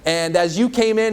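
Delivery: ordinary delivery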